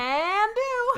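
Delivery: high, perky cartoon catchphrase voice; Mister Meeseeks. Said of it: "Little Mister Meeseeks".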